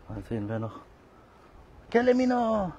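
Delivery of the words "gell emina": very high, loud sing-song call. Real, not acted. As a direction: call the cat's name far across the park. Calling cat, very high. (sehr hoher, lauter Lockruf)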